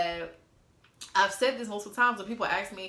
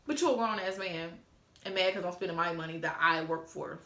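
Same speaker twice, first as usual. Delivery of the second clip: ordinary talk, nothing marked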